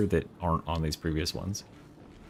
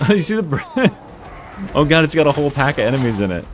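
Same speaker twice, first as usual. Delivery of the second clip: excited; 6 semitones higher, 4 dB louder, faster than usual. Real, not acted. Enemy pack bursts out.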